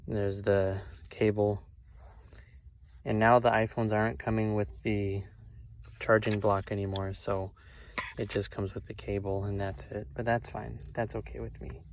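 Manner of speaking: ordinary delivery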